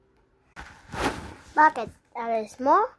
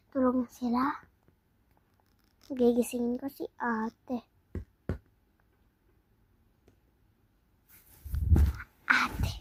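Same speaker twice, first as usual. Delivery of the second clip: hushed night talk; quiet at night; 2 semitones lower, 6 dB quieter than usual. Acted. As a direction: keep it hushed, people asleep. Sneaking at night whispering.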